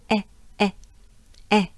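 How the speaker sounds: "E" as short open French è, like "eh"; clipped, separate "eh" for "E"; open E sound. Shown as a demonstration. Teacher models last sound for learners, open E sound. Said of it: "last will be the sound E".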